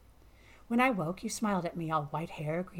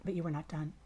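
usual speaking voice

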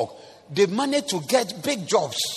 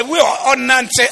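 mother's loud retort; 8 semitones higher, 7 dB louder than usual. Retold or imitated. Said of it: "Oba say".

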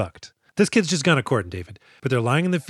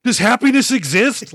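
mock dramatic Shiro; 9 semitones higher, 6 dB louder than usual. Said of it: "Shiro's like".

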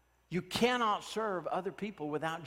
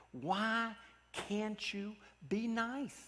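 slow, higher, softer plea; pleading question; 4 semitones higher, 8 dB quieter, much slower than usual. Retold or imitated. Preacher finally asks the man to be nice, slow high plea. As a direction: draw each word out, high and soft, imploring.

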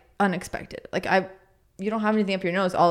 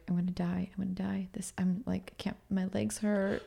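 hushed, near-whispered worn-out muttering; exhausted whimper; 13 dB quieter than usual. Retold or imitated. Runner retelling the day after the race, hushed exhausted near-whisper. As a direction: act out being spent, much softer and whispery.

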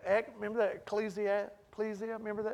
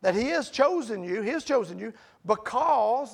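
loud scripture quote; 4 semitones higher, 9 dB louder than usual. Acted. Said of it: "He says".

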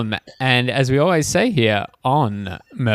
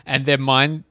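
ordinary delivery

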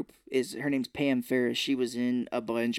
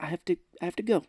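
flustered exit; 4 semitones higher, much faster than usual. Retold or imitated. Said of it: "he's like".

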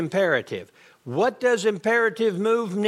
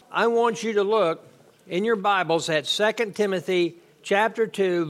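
usual speaking voice

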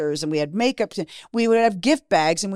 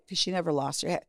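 ordinary talk, nothing marked

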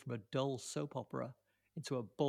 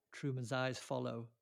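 usual speaking voice

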